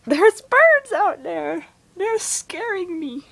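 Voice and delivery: scared. Real, not acted. Birds surround the house.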